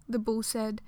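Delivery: plain reading voice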